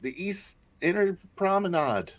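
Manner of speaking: high, urgent plea; frantic call. Acted. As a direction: beg security for help. Player frantic call to security.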